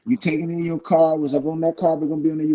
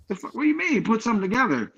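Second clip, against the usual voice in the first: incredulous; 5 semitones higher, faster than usual. Retold or imitated. Incredulous retort to hometown promoter.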